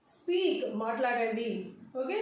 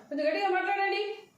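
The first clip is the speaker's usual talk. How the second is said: quick, higher-pitched command; disrespectful demand; 6 semitones higher, faster than usual. Shown as a demonstration. Teacher shows how not to ask a caller, disrespectfully. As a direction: make it a brisk order, not a question. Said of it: "ఇర్రెస్పెక్ట్గా ఉంటుంది".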